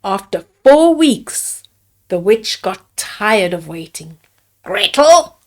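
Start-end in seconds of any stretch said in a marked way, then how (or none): 4.6-5.4 a louder call, rising at the end. Acted. Witch calling Gretel to check the oven, loud call. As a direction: call the name across the kitchen. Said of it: "she called".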